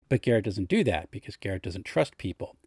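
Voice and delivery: ordinary talk, nothing marked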